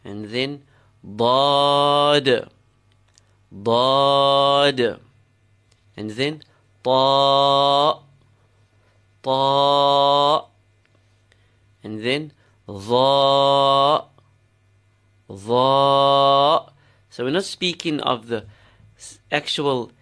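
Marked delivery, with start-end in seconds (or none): 1.0-5.0 dad with a long, level back ah; full-letter sound. Shown as a demonstration. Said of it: "names of the full letters". 6.8-10.5 ta held long with a back ah; full-letter sound. Shown as a demonstration. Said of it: "names of the full letters". 12.7-16.7 dha held long with a back ah; full-letter sound. Shown as a demonstration. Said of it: "names of the full letters".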